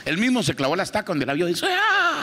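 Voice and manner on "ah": high-pitched panicked scream; terrified scream. Acted. Dracula shrieks at the ugly sister, high scream. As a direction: shriek in horror at the sight. Dracula stakes himself in terror.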